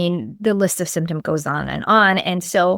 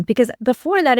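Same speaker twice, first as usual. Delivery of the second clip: normal talking voice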